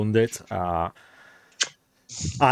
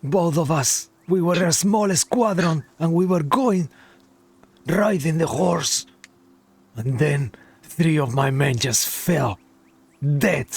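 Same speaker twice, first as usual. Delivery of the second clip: put-on shaken soldier, light Russian accent, higher pitch; half Russian accent; 5 semitones higher than usual. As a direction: recount the horror with a hint of Russian. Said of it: "half Russian accent now".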